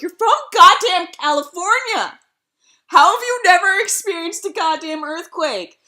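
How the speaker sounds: retold exasperation, hitting the words hard; exasperated retort. Exchange students to their panicking classmate, loudly exasperated.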